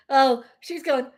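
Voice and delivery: ordinary talk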